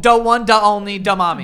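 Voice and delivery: loud, mock Colombian accent on da; mock Colombian mom. Friends mocking a Colombian mom, loud mock accent. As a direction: ham up a Colombian mom saying da mommy.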